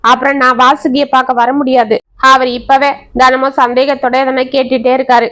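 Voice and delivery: ordinary delivery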